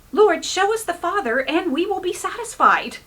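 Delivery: high, insistent plea; a pushy disciple wanting proof; demanding Philip. Retold or imitated.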